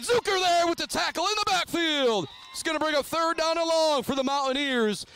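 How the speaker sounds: sudden high play-by-play cry, gradually easing; thrilled sportscaster, pitch leaping up; excited tackle call. Real, not acted. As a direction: burst out at the big stop, then ride the momentum. Live call of backfield tackle.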